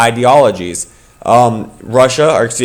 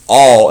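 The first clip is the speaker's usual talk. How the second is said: all leaned on, louder; stress on ALL; 6 dB louder than usual. Shown as a demonstration. Teacher stressing all for the class. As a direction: lean hard on all so it lands. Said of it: "and I'm emphasizing all there".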